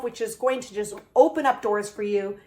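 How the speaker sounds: ordinary talk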